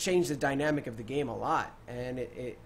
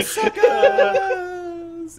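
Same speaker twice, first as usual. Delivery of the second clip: very high, loud, long-held call; taunting mock shout; 9 dB louder than usual. Asura taunts the line as he drops, imitated drawn-out holler. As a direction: stretch the jeer out gleefully.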